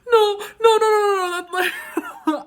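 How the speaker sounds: loud, high mock wail breaking into laughter; re-enacted frantic, high-pitched protest; panicked. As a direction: blurt it out in exaggerated panic. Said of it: "I, like, panicked".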